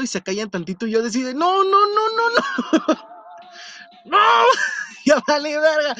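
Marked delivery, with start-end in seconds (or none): 1.3-3.1 mock panic. Realizing the strangers heard his city accent, mock panic. 4.1-5.9 much higher, louder, half-laughing cry; mock terrified wail. Sure the strangers will beat him up, laughing wail.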